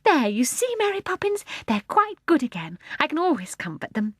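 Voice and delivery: a doting mother showing off; a mother pleased with herself; proud mother. A narrator's reading. Mother voiced in story.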